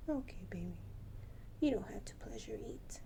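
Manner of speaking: gentle whisper. Real, not acted. Owner reassuring her full bird, whispered. Soothing pet softly.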